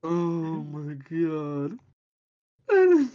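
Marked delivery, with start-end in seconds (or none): 2.7-3.1 exasperated outburst. Real, not acted. Bursting into exasperated reaction.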